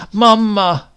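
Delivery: put-on high, loud child's cry of Mama!; a small child crying out Mama! loudly; child calling Mama. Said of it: "he wouldn't have spoken in that child's voice".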